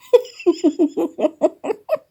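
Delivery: high-pitched laugh; high laughing fit. Real, not acted.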